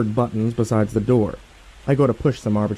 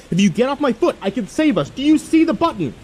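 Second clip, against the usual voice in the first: much higher, frantic pleading; desperate plea; 14 semitones higher than usual. Acted. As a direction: beg him to move, panicking. Pleading with John while falling.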